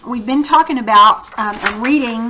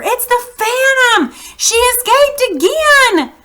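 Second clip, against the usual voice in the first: high excited echoing shout; crowd cry; 13 semitones higher, 5 dB louder than usual. Acted. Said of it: "The people took up the cry".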